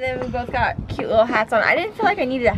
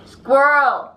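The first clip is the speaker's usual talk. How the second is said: squirrel call demo; 2 semitones higher, 6 dB louder than usual. Shown as a demonstration. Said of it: "And now you say".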